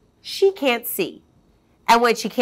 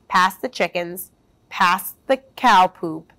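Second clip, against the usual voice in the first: ordinary delivery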